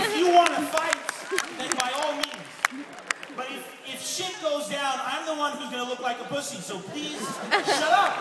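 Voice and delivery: exasperated pleading. Retold or imitated. Pleading with angry girlfriend.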